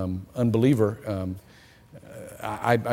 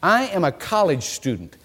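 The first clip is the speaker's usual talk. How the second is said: imitating an indignant student, higher and emphatic; mock indignation, pitched up and insistent; 7 semitones higher than usual. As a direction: push back in a raised, affronted voice. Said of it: "She looked at me indignant".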